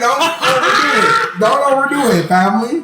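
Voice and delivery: high, laughing, slow scold; laughing mock God scolding. God scolding the man in heaven, high laughing scold. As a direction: chide him through laughter from above.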